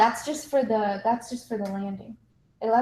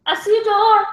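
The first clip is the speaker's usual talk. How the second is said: higher, louder excited discovery; 11 semitones higher, 11 dB louder than usual. Pretend. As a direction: burst out at the find.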